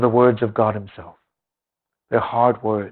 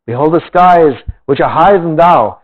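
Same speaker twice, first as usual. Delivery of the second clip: measured, solemn Bible reading; scripture reading; 4 semitones higher, 6 dB louder than usual. Acted. Preacher reading Job to the congregation, solemn scripture voice. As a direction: read the old words with weight. Reads Job aloud in sermon.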